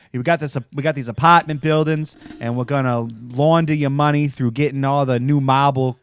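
imitating a hammed-up Boston accent; mock sales pitch in bad Boston; [name]'s bad Boston accent. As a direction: lay on a fake Boston accent, dropping the R's. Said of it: "he has the worst Boston accent".

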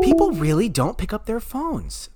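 high, slow exaggerated complaint; high and drawn out. Shown as a demonstration. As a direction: stretch it out up high. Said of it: "Okay, no, no, no".

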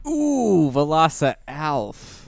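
slow, savoring the name with a high ooh; drawn-out delight. Real, not acted. Friend repeating the name, slow and delighted. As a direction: relish each syllable of the name.

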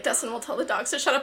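ordinary delivery

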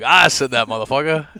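loud, laughing tough-guy line; mock tough voice. Translating a slang tag into a loud tough-guy retort. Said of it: "That's African for, like".

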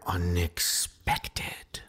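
slow, drawn-out whispered sign-off; spooky whisper. Pretend. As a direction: whisper it slowly for mock suspense. Teasing the show's sign-off word.

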